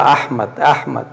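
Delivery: "Ahmad, Ahmad" spelled out slowly, each syllable clear; patient, slow drilling of "Ahmad"; slow careful name drill. Shown as a demonstration. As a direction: repeat "Ahmad" slowly like a teacher drilling a student. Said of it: "falando pra ela".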